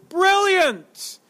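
high-pitched exclamation of delight; crowd's amazed cheer. Acted. Preacher voicing the people's reaction to the answer, high delighted exclamation. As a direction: cry out like an impressed crowd. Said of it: "The people go".

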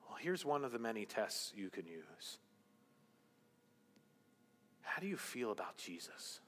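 ordinary talk

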